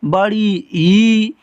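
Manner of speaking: बड़ी ई drawn out very slowly; long drawn-out ee. Shown as a demonstration. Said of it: "बेटा इसको ऐसे पढ़ते हैं".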